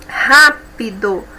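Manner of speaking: "Rápido" slowly, "Ra" stressed; slow stressed demo. Shown as a demonstration. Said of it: "Ra é a sílaba mais forte". ("Rápido" devagar, com força no "Ra")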